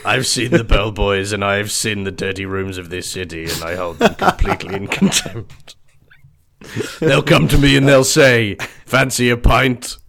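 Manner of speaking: loud, gruff hard-boiled narration; Rorschach voice. Pretend. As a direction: growl it like a grim vigilante. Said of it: "reminds me a little bit of Rorschach".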